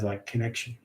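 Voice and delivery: ordinary delivery, nothing marked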